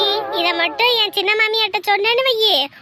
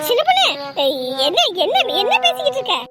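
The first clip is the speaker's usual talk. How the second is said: flustered; faster than usual. Acted. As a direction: cut in sharply, alarmed at the gossip. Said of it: "இப்படி பதற".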